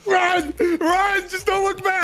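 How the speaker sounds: high urgent yelling; frantic shouting. Real, not acted. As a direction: shout at them to run. Urging teammate to flee.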